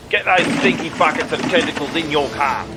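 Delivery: ordinary delivery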